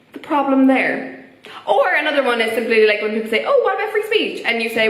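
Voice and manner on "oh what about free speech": high-pitched mocking imitation; put-on indignant question; mock objection. Mimics people objecting about free speech.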